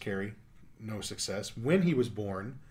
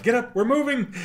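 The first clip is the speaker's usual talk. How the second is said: loud high commanding shout; mock shout; 13 semitones higher, 9 dB louder than usual. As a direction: bark it like a fed-up homeowner. Mock order to family.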